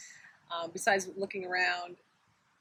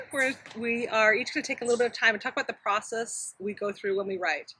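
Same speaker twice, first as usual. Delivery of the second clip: usual speaking voice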